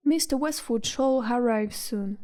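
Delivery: formal English butler. Acted.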